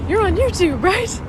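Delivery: high, loud and eager, imitating the guy; excited recognition. Imitating the van guy recognizing her, high and excited. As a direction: imitate a stranger thrilled to spot a YouTuber.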